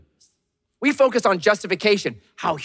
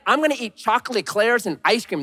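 ordinary talk

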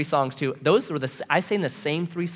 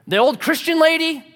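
loud, high-pitched disbelieving question; mock disgusted query; scornful incredulity; 13 semitones higher, 6 dB louder than usual. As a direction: imitate the grouchy neighbor, voice shooting up. Mean neighbor's incredulous question.

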